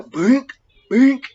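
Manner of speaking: sharp high "bink" noises, one after the other; playful sound effect of blows; punch sound effect. Retold or imitated. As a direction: mimic two quick punches landing. Imitating punches landing.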